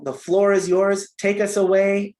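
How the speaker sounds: ordinary talk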